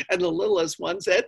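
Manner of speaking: ordinary delivery, nothing marked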